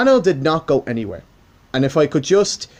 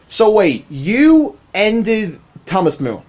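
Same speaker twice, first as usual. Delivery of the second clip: higher, drawn-out baffled reaction; mock disbelief; 4 semitones higher, much slower than usual. Baffled viewers reacting to the channel ending, in mock disbelief. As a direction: stretch it out like a stunned fan.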